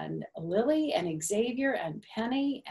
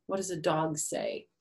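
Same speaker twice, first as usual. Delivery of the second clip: plain reading voice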